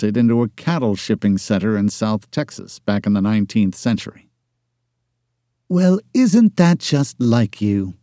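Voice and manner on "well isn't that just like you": higher, slow, drawn-out exasperation; exasperated. A narrator's reading. Erin fed up with her boss's excuses, exasperated. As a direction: sigh the words out, fed up with him. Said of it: "she said, exasperated".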